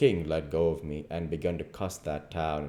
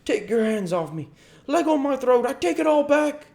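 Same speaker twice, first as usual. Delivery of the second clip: very high, loud, panicked squawk; choking cry for mercy; 8 dB louder than usual. A narrator's reading. King being throttled by the duke, panicked cry. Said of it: "The king sings out".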